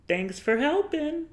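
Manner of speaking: put-on sweet little girl voice. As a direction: play the innocent little girl thanking the grown men. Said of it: "She turns to these grown men".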